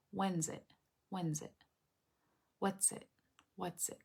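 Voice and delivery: very quiet, spaced repetitions; soft little phrases, each set fading; repetitive sound drill. Shown as a demonstration. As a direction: practise under your breath. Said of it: "show you how I drill sounds".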